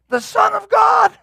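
high-pitched preacher's shout; impassioned shout. Real, not acted. Preacher on Jesus being called a devil, impassioned shout. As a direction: cry it out far above your talking pitch.

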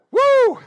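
high, loud exultant whoop; joyful woo. Real, not acted. Pastor shouting praise to church, exultant whoop. Joyful cry mid sermon.

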